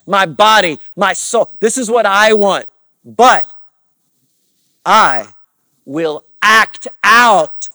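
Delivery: loud, slow, forceful preaching shout; emphatic declaration. Acted. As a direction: hammer out each phrase at full volume. Acting out flesh versus obedience.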